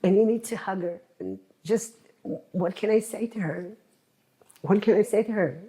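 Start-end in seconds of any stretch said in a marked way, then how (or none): 4.6-5.6 rushed, pleading repeat; fast desperate repeat. Real, not acted. Grieving relative repeating her helpless question, rushed. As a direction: blurt the question out again, faster.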